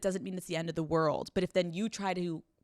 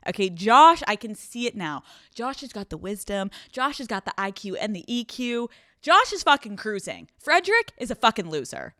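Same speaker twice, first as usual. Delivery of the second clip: hyping Josh up; 4 semitones higher, 7 dB louder than usual. Real, not acted.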